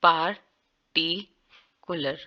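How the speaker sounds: पार्टिकुलर very slow, syllable by syllable; पार्टिकुलर dragged out slowly; slow demo. Shown as a demonstration. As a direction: draw out every sound of पार्टिकुलर. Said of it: "वाजिया बोला जा रहा है".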